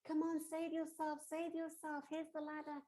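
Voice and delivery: loud, high, pressing urgency; urgent rescue plea. Acted. Rescuer offers a drowning lady the ladder, urgently calling. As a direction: call out to someone in danger.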